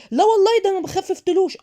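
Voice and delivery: high, loud indignant denial; defensive protest. Acted. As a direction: protest your innocence far too hard. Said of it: "هتقعدي انت تدافعي؟".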